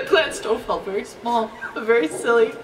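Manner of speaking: cracking up while quoting the story; laughing. Real, not acted. Reader repeats the story's line to themselves, laughing. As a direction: repeat it through real giggles.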